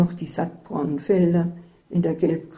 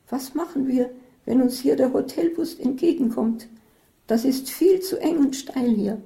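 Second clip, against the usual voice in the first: higher, fretful warning voice; anxious warning; 7 semitones higher than usual. A narrator's reading. Isabel warns her friend at the wheel, higher and anxious. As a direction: fret about the narrow road ahead. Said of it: "warnte Isabel und blickte angststarrend".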